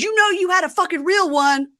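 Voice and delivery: high-pitched mock yell; acted angry shout; retold parting shot. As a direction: hurl it back as you storm off. Acting Rob turning to yell.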